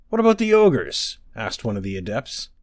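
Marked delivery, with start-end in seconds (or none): 0.1-1.2 high, startled question; alarmed question. Acted. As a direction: ask in sudden alarm. Worried adept asks.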